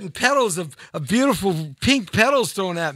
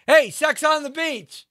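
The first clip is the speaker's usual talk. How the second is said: mock calling out; 9 semitones higher than usual.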